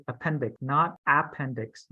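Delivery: first "Appendix" stressed on pen, second on ap; right then wrong stress. Shown as a demonstration. Teacher contrasting right and wrong stress for students. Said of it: "second word is where you put the emphasis".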